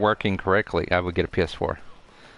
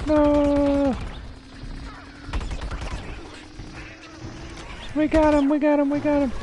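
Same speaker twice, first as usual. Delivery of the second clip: high excited shrieks. Real, not acted. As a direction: shriek wildly, high-pitched. Chaotic fight in game.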